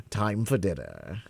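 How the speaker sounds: ordinary delivery, nothing marked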